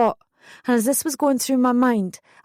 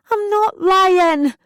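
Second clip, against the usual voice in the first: very high, loud and slow scream; screamed back; 10 semitones higher, 5 dB louder, much slower than usual. A narrator's reading. Daughter screaming back at her accusing mum, loud slow scream. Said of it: "I screamed back".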